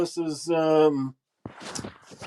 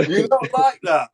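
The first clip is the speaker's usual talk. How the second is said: imitating Tunde; 6 semitones higher than usual. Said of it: "he's comedy gold".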